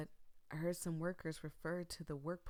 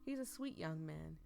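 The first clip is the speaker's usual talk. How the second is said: ordinary talk, nothing marked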